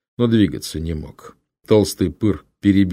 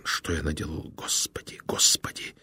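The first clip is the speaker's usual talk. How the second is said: horrified whisper; 2 semitones lower, 9 dB quieter than usual. Acted. Horrified killer whispers to God.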